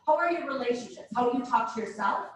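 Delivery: ordinary talk, nothing marked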